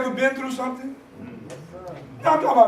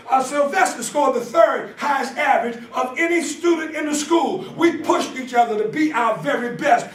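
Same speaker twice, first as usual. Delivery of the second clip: raised preaching voice, driving each phrase; 4 semitones higher, 3 dB louder than usual. Acted. Preacher rallying the congregation about school brothers, preaching.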